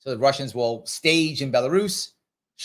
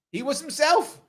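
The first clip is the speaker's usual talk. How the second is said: ordinary delivery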